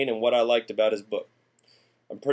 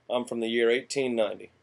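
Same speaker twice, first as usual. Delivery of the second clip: ordinary delivery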